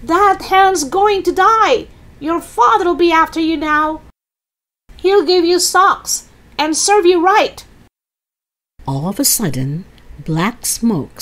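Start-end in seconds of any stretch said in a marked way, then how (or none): 0.0-7.6 put-on high, gloating old-woman holler; scolding Grandma. Grandma warns George about his father, high scolding. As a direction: threaten the boy in a high voice.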